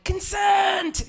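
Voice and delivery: loud high cry of consent; shouted protest. Retold or imitated. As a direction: bark it out like an alarm. Yelling during choking.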